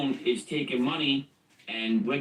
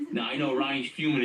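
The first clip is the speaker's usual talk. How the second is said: usual speaking voice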